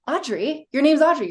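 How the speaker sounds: rising, then a loud insistent correction. Pretend.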